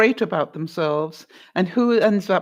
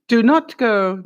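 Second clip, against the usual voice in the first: ordinary talk, nothing marked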